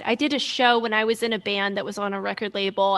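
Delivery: ordinary delivery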